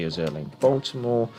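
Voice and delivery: normal talking voice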